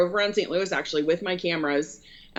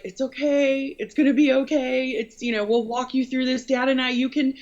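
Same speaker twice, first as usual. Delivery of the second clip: imitating a reassuring mother, voice pitched up; loving mom; 7 semitones higher than usual. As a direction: soothe her upset daughter like mom did. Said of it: "it was so sweet and loving".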